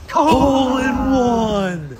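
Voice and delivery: drawn-out, high thrilled yell; excited shout. Real, not acted. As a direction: call the hole in one like a stadium announcer. Hole in one on mini golf.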